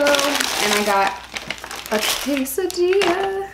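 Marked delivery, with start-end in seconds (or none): 1.9-3.5 silly high voice. Pretend. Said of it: "I just said that like that".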